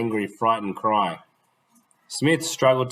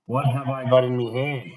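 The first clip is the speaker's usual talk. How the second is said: put-on gruff boy; 3 semitones higher than usual. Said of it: "he asked gruffly".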